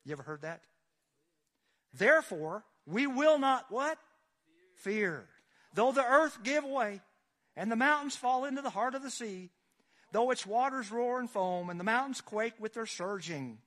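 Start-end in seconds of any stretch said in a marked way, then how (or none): 1.9-13.6 preaching at full force. Acted. Pastor proclaiming the psalm to the congregation at full force. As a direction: thunder the verse out over the pews.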